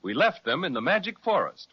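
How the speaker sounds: ordinary delivery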